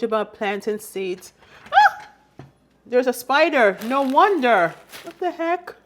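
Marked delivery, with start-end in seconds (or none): 1.7-2.1 sudden high loud shriek; startled yelp at a spider; screaming at a spider. Real, not acted. 2.8-5.7 louder, higher alarmed exclaim; shocked, raised voice; startled by a spider. Real, not acted.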